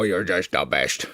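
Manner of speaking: soft, slow coo to the dog; low soft murmur to a dog in the arms. Real, not acted. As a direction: murmur it tenderly to the pet. Cooing to the dog.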